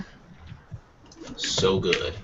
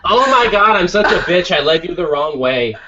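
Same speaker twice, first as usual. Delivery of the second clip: put-on high, loud valley girl gush; 9 semitones higher, 6 dB louder than usual. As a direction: squeal it like a ditzy valley girl. Valley girl GPS continues bit.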